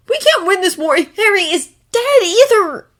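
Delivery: cracking with emotion. A narrator's reading. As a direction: voice breaks on the line. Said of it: "his voice cracking".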